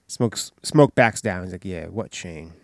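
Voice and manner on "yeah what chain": low, hushed and meek, backing down; meek echo. Retold or imitated. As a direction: echo it quietly like you're scared. Smoke echoing, backing down meekly.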